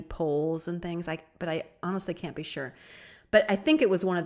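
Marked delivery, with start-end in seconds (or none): none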